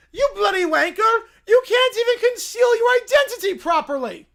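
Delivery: loud, high ranting yell; furious shouting. Acted. Bear berating Peppa on the phone. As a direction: scream the insult.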